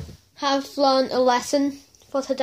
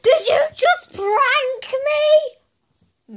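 high, drawn-out squeal; hammed-up high-pitched outrage; indignant disbelief; 11 semitones higher, much slower than usual. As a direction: demand it slowly in a high voice. Acted indignation at prank.

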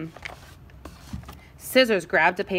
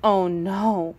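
ordinary delivery

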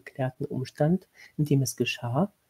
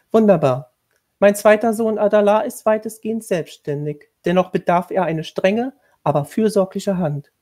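higher, louder, formal commanding tone; stern lady of the house; 6 semitones higher, 7 dB louder than usual. A narrator's reading. Lady of the house instructing the new governess, stern and formal. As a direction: lay down the duties like a strict mistress.

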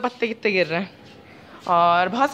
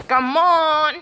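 higher, louder urging; eager call; 5 semitones higher, 4 dB louder than usual. Real, not acted. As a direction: cheer her on. Urging child. (बच्चे को जोश से बुलाना)

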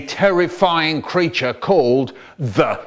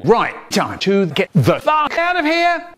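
urgent; 8 semitones higher than usual. Acted. Urgent escape line.

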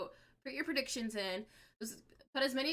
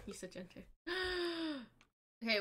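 ordinary talk

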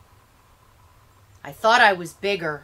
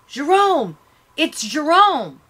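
high, loud cry of the name; crowd calling out; 7 semitones higher, 5 dB louder than usual. A narrator's reading. Neighbours recognizing the dead boy, loud cry. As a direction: call out as you recognize him. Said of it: "Some shout".

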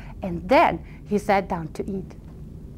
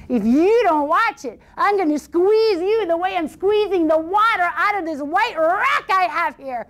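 a touch of a cocky threat, slightly higher; boasting boy; 8 semitones higher, 3 dB louder than usual. Acted. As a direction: bluff him with puffed-up confidence. Boy bluffs the troll.

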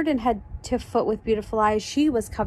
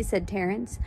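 ordinary delivery

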